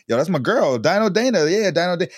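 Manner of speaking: excited fan. Retold or imitated. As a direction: gush like a thrilled little fan. Voices daughter's delight at favourite show.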